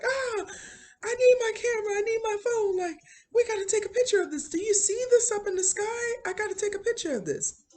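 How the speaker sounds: very high, fast, frantic squeal of a hurt man; mock panicked high pitched plea; frantic injured man. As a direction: shriek it out, desperate for his camera.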